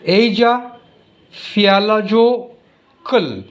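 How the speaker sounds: Asia Fialajokul, sounded out slowly from the spelling; spelling pronunciation. Shown as a demonstration. Teacher puzzling out a volcano's name for the class, spelling pronunciation. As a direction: read "Asia Fialajokul" piece by piece as written. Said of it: "this pronunciation and this pronunciation is so different".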